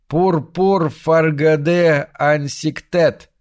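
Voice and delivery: высоко, медленно, жутко завывая; протяжный вой жуткого младенца; завывающий нечеловеческий голос. A narrator's reading. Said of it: "завывающим нечеловеческим голосом". (окровавленный младенец во сне завывает нечеловеческим голосом)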